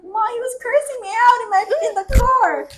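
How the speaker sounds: high-pitched imitation of complaining to mom; whiny tattling. Retold tattling to mom while drunk.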